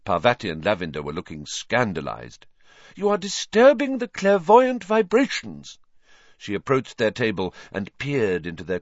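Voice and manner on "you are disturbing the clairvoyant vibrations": high, louder mystic reproach; indignant seer. A narrator's reading. Trelawney rebuking noisy students, lofty and high. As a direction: chide them in a lofty airy voice.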